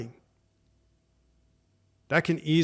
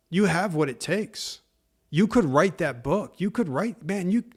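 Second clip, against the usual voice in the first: fired-up encouragement; 3 semitones higher than usual. Acted. Pastor firing up parents to believe in kids, excited. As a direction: pump them up like a coach.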